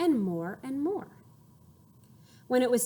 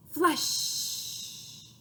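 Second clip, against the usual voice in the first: soft breathy flush noise; 5 semitones higher, 4 dB quieter than usual. Shown as a demonstration. Imitating the toilet flush. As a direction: whoosh it like a toilet.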